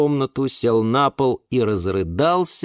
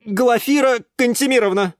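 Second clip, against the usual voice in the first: a mock-stern, higher young man; 12 semitones higher, 4 dB louder than usual. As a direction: address her formally and sternly. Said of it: "строго произнёс Юра". (строго, с лёгкой важностью)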